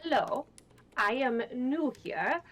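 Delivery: normal talking voice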